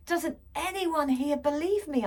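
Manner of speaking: put-on exasperated girl yelling; crying out. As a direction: demand to be believed. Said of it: "I cried".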